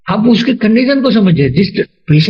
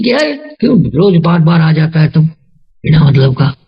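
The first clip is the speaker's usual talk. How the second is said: ordinary delivery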